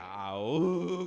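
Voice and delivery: a drawn-out mock camel cry; imitating the camel finding its voice; camel bray. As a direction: let out the animal's groan. Camel suddenly makes sound.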